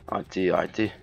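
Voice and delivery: ordinary talk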